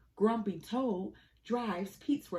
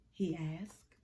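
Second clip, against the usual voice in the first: usual speaking voice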